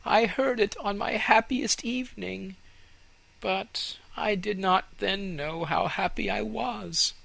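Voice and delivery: high, wistful tree voice; wistful fir tree. Acted. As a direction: remember it longingly.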